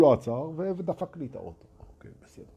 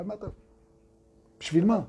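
ordinary delivery

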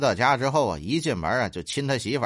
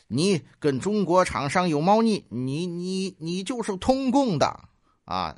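higher-pitched, hammed-up accusing rant; mock angry scolding; 7 semitones higher than usual. Lawmakers berating the House speaker, acted angry scolding. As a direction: scold with stammering mock outrage. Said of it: "就开始痛骂".

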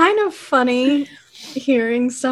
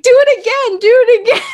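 put-on kids egging her on; 10 semitones higher, 6 dB louder, faster than usual. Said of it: "they were just like".

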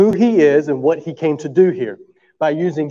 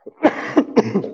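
cough; 6 semitones higher than usual. Real, not acted. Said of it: "I promise, if I cough".

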